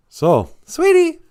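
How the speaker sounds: very high, slow, loud sugary voice; high mock cohost voice; sweet cohost voice. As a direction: coo like a sweet radio cohost. Radio host voicing cohost.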